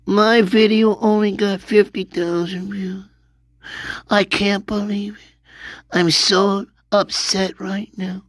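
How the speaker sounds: high-pitched fake upset voice; mock whiny crybaby. Streamer mimicking creators upset over views, mock whining. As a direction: whine it high, making fun.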